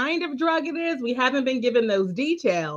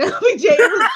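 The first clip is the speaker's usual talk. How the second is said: laughing; 7 semitones higher, 6 dB louder, much faster than usual. Real, not acted. Laughing mid-story.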